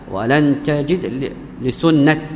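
لسنة stopped on a crisp final t; pausal t. Shown as a demonstration. Teacher showing the majority's stop, لسنة ending in t. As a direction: end لسنة as li-sunnat, no vowel after it. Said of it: "تقف عليها بالتاء".

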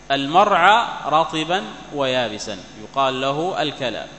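ordinary talk

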